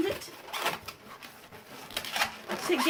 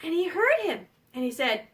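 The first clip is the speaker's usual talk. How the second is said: usual speaking voice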